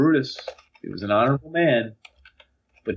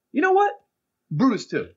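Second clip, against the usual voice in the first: high-pitched mock realization, settling after; improvising student; 13 semitones higher than usual. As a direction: imitate a kid winging his speech. Acts Drew improvising his speech.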